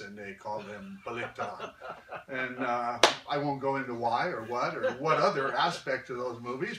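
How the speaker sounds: laughing through the words. Real, not acted. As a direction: chuckle while telling it.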